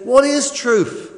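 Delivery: high, slow, scornful Pilate asking what is truth; cynical question. Retold or imitated. Pilate sneering at Jesus, slow and high. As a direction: let the doubt drip off every word. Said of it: "the great cynic".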